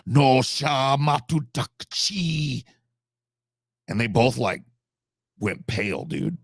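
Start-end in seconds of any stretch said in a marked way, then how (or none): 0.1-2.6 mock Korean threat. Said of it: "she turned around, and she went".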